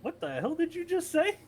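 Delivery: high, baffled outburst; disbelieving what the hell; incredulous. Retold or imitated.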